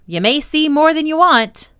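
high, loud teasing of a little sister. A narrator's reading. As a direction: taunt her with a playful warning. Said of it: "dearly loved to tease his sister".

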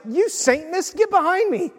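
high-pitched retold rebuke; harsh rebuke. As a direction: act out a sharp telling-off. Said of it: "that's kinda harsh".

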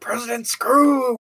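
delighted shout; excited shout. Acted. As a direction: bellow the name with glee. Calling out Spaceballs character.

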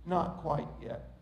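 low, hushed, slow reply; quiet, unruffled gentle refusal; smiling potter. Acted. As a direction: answer softly and patiently through a smile. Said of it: "he just smiled and said".

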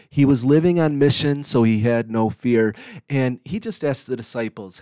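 normal talking voice

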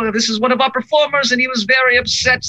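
tiny mayor voice, pitched high; high-pitched character voice. Acted. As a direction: puff up like a little official. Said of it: "It's gonna go".

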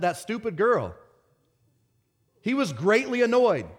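ordinary delivery

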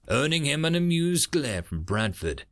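ordinary delivery

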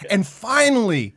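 normal talking voice

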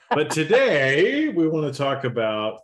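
slow, drawn-out and loud opener; talking slow. Acted. Podcast host opening the topic, deliberately slow and loud. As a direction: stretch the words out on purpose. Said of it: "So I was talking slow there for a while".

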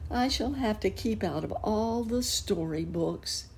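high, woeful cat telling his trouble; sad cat voice. Acted. Puss in Boots telling Uncle Wiggily his sorrow, high and woeful. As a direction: plead your misfortune in a high cat voice.

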